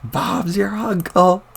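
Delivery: put-on high, very slow folksy old-man line; slow, high old-timer catchphrase; old-timer exclamation. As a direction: drawl it out like a pleased grandpa. Old grandpa exclaims while powering up.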